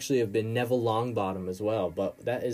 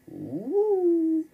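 rising high "ooh", held; mock spooky ooh; 5 dB louder than usual. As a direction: swoop up loud into a long, teasing ooh. Mock spooky reaction to the name.

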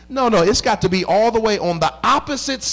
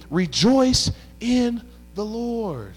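slow, drawn-out sermon delivery; preaching; 4 semitones higher, 3 dB quieter, much slower than usual. Acted. Pastor reading Paul's call to rejoice, slow. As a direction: stretch the words out for the church.

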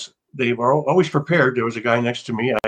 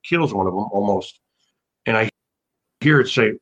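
ordinary delivery

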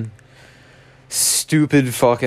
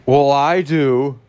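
obnoxious fan; 6 semitones higher, 4 dB louder, slower than usual. Retold or imitated. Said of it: "And he goes".

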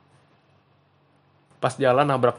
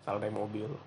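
normal talking voice

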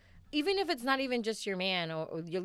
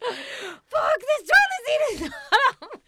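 high, loud mock outburst; hammed-up furious shout; retold yelling; 8 dB louder, slower than usual. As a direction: re-enact yelling at him, far above your usual pitch. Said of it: "yelling at him about it".

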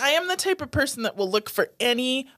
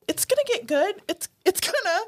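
high, eager mock hope; willing the movie to improve; pitched-up wishful thinking; hopeful; 10 semitones higher than usual. Retold hopeful thought.

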